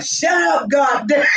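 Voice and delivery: high-pitched retold yell; exasperated mock yell. Ronnie woken by howling dogs, high mock yell.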